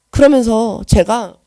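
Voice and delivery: normal talking voice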